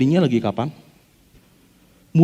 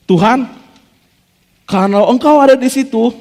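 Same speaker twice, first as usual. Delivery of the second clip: louder, higher bold request to God; bold Peter; 6 semitones higher, 4 dB louder than usual. Acted. Preacher voicing Peter's bold claim, louder and higher. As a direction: say it boldly, full of nerve. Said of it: "ngomong sama seperti Petrus ngomong".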